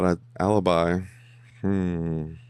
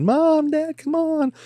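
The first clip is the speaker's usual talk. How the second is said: put-on much higher whiny child voice; mock kid voice; 3 dB louder, much slower than usual. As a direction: whine like a kid begging his parents. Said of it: "the kid said".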